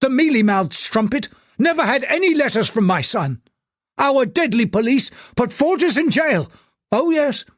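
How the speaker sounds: furious tycoon. Acted. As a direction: bark him down as a liar. Angry tycoon tirade on the phone.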